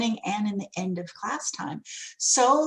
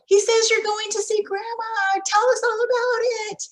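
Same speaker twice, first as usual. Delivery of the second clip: high, louder cheerful teacher voice; cheerful greeting; 12 semitones higher, 4 dB louder than usual. Shown as a demonstration. Teacher greeting a parent about grandma, high and cheerful. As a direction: chirp it brightly to the parent.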